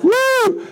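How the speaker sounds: very high-pitched celebratory "woo"; party whoop. Acted. Crowd having a blast round the calf, high whoop. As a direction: whoop like a reveler. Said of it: "they're hooping and hollering".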